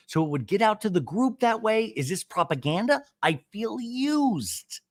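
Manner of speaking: high-pitched mock grievance, stretching the last word; aggrieved complaint. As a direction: retell it like a wounded accuser. Acts out a cast member's complaint.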